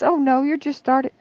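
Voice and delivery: high, dismissive nurse voice, imitated; imitating the nurse. Nurse dismissing the mother, imitated high and breezy. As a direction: brush her off like a breezy nurse. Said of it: "She says".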